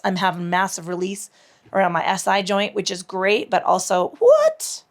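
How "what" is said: high startled what; surprised. Real, not acted. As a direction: jolt up in surprise. Sudden noise from phone.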